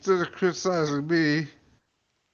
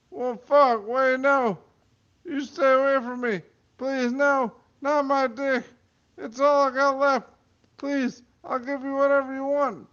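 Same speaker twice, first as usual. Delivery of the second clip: high frightened begging; 12 semitones higher than usual. Acted. Man begs for his life.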